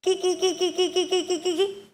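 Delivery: high repeated kee-kee chirps; parrot call. Shown as a demonstration. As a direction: chatter like a parrot in quick short notes. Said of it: "വിളിക്കുന്നത്".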